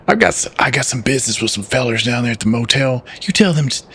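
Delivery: lightly voiced Southern uncle; Southern accent. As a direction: keep a mild Southern twang. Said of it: "I gotta do my southern voice".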